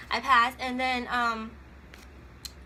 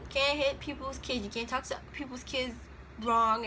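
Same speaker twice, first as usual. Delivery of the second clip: ordinary talk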